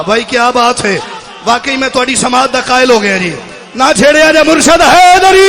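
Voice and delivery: full-force majlis cry to the crowd; loud, rising rallying call; preach. Real, not acted. (पूरे ज़ोर से मजलिसी ललकार)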